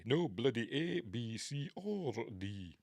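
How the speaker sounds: put-on Scottish accent; Scotty. Shown as a demonstration. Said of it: "so I'll do a Scotty".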